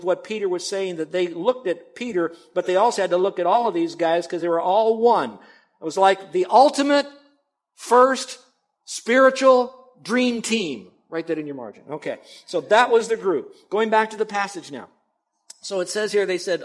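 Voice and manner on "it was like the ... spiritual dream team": louder and higher, slowing down on dream team; building excitement. Real, not acted. Preacher hyping the apostles as a dream team, building excitement. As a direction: build it up like an announcer crowning the best.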